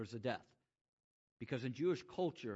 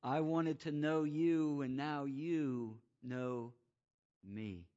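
slow intimate; slower than usual. Acted. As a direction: draw it out tenderly to a hush. Slowing intimate Jesus words.

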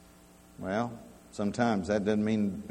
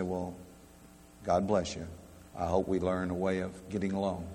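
soft, lower-pitched conciliatory reply; gentle blessing; 5 semitones lower, 9 dB quieter than usual. Acted. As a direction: answer an insult softly and low, to make peace. Models a gentle reply to insult.

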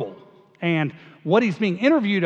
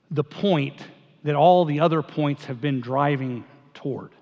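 normal talking voice

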